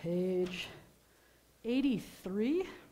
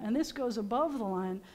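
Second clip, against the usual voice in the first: ordinary delivery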